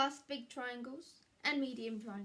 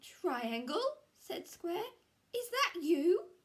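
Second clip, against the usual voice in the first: higher, louder, suspicious; surprised question; 9 semitones higher, 7 dB louder than usual. Acted. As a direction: ask as it dawns on you. Square realises trick.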